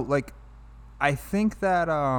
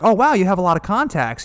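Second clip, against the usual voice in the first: higher, louder, lightly acted surprise; mock amazement; 7 semitones higher, 5 dB louder than usual. Samantha teasing Theodore about his contacts, lightly mock-amazed. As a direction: act mildly impressed, pitching it up.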